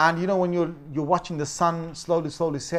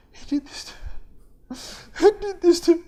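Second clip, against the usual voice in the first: high, breaking into a louder whining sob; fake crying adult; 13 semitones higher, 4 dB louder, faster than usual. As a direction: put on a sobbing complaint. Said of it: "adults can really make a face".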